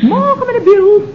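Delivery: cheerful loud high morning hello; loud greeting. Acted. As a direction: boom the greeting. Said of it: "Zachtjes, zachtjes".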